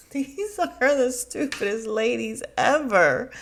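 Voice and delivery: laughing scorn; amused disbelief. Real, not acted. Vlogger mocking the cast to viewers, amused.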